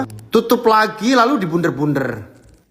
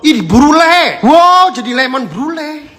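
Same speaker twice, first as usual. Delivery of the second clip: excited exclamation; 8 semitones higher, 6 dB louder, slower than usual. Real, not acted. Excited at recipe.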